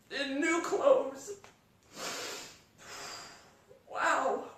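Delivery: choked up and grateful; tearful. Real, not acted. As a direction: voice breaking with real tears. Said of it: "It's okay that I'm crying".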